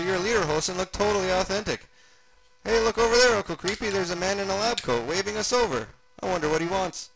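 put-on high, eager child character voice; high-voiced little sidekick, chattering; Gunga kid character. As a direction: pitch it up like a curious kid.

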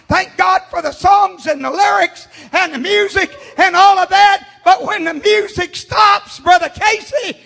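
building to full-voiced preaching; rising preaching shout. Real, not acted.

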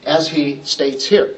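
normal talking voice

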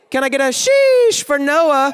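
high, loud, drawn-out SHEESH; rally the crowd, stretching "sheesh" high and slow; hyped crowd call. Real, not acted. Calling for a crowd cheer.

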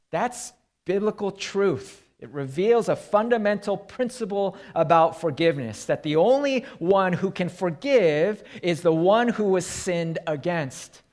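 ordinary delivery

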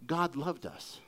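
soft and low, tender; quiet heartfelt assurance. Real, not acted. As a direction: say it gently, letting it land. Repeated tenderly.

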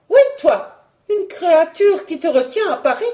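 high, loud, indignant wife; high-pitched jealous outburst; jealous accusation. Acted. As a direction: hurl the accusation at him. Wife accuses husband of an affair.

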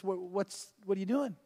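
very soft gentle rebuke; the Lord's question. Retold or imitated. Lord questioning the preacher, soft rebuke. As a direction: ask it softly, reproving. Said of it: "the Lord says".

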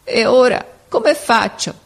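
slow, high question choked with a sob; sobbing question. A narrator's reading. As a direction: ask it through a sob. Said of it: "domandò con un singhiozzo". (domanda lenta e acuta, con un singhiozzo)

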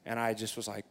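ordinary talk

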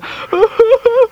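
high hammed-up sob; hammed-up high wailing sob; mock sob. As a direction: burst into a fake sob. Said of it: "sitting there sobbing".

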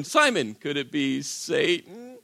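Jesus done lightly as the Church Lady. Retold or imitated. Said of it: "that's all I can think of".

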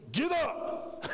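high, sharp pastor's command; sharp order. Retold or imitated. Stern pastor orders the weeping young sailor up, high and sharp. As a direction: bark it like a stern preacher.

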